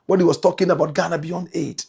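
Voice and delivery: ordinary talk, nothing marked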